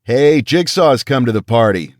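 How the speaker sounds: loud call across the room; yelled. A narrator's reading. Teammate shouting to the squad about Jigsaw, loud. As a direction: shout it out to the team. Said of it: "Bunny yelled".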